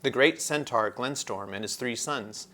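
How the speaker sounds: plain reading voice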